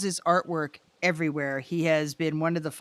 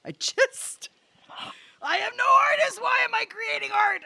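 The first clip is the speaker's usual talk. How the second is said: mock panicked shout. Freaking out over artist neighbors, high panicked shout. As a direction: yell it like a meltdown. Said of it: "And I'm like".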